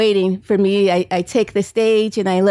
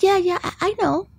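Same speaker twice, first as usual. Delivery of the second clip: put-on much higher, lightly childlike; little son; 9 semitones higher than usual. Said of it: "he said".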